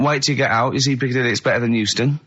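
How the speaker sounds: ordinary delivery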